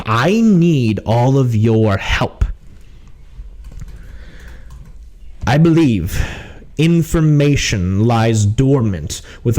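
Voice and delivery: measured, emphatic officer's briefing; stern captain. Acted. Captain asks mercenaries for help, stern. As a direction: recruit them as a stern captain.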